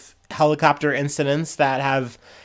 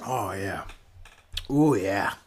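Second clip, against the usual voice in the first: savoring a sip, low and relaxed; satisfied sigh after a drink; while sipping a drink; 7 semitones lower, 5 dB quieter, slower than usual. Real, not acted. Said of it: "let me take a sip".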